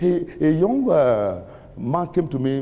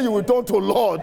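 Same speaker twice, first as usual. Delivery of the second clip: higher, insistent proclamation; emphatic re-declaration; 6 semitones higher than usual. Real, not acted. Preacher repeats a blessing after no amen, emphatic declaration. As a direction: declare it high and forcefully.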